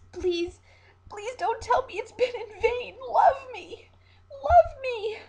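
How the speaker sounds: high, loud tearful pleading that builds to a sobbing wail; crying out, pleading. Acted. Aged siren begging the captain to love her, crying. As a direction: beg him like a broken child, rising into sobs. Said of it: "Cried out in her voice, in her naivety".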